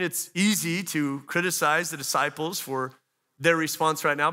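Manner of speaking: ordinary talk, nothing marked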